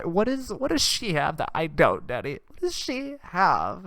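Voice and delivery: put-on high, wailing teenage girl; whiny teen daughter. Daughter wailing to her dad about Blake, high and whiny. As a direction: sob it out like a heartbroken teenager. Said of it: "She's like".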